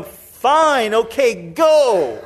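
fed-up parent's reluctant yes; exasperated giving in. Acted. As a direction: give in so they catch your displeasure. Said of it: "you hope they catch the tone".

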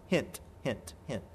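very soft, low aside; quiet, pointed nudge; hinting aside. Acted. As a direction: slip it in under your breath. Same sly hint repeated.

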